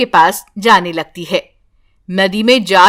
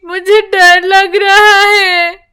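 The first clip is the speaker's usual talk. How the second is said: slow, higher, frightened; scared little girl; 8 semitones higher, 4 dB louder, slower than usual. A narrator's reading. Granddaughter tells grandma she is afraid, slow and scared. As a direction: confess the fear with a shaky child voice.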